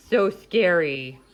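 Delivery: loud, slow, mock-scared exclamation. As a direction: pretend the dog terrifies you. Mock fear of dog.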